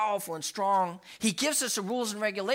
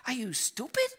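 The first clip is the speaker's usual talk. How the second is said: high-pitched incredulous question; retold exasperation; 8 semitones higher than usual. Father to son who touched the stove, retold exasperation. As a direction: ask it in mock disbelief.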